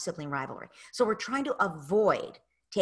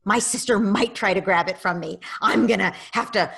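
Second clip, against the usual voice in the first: put-on louder, act out the grabby kid's fear; voicing a child; 7 dB louder than usual. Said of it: "child's inner voice".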